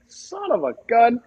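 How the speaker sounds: high, slow, stretched-out amazement; amazed exclamation. Retold or imitated. Bowler to opponent, slow high amazement. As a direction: draw it out in happy shock. Stunned reaction to winning spare.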